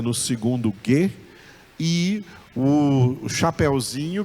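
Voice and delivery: usual speaking voice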